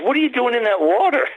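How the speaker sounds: high, mock yell of a fifteen-year-old; imitating a kid shouting from the riverbank; teen kid's voice. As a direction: pitch it up like a curious teenager. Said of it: "He goes".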